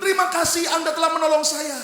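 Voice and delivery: lightly voiced high grateful woman. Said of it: "wanita ini berkata".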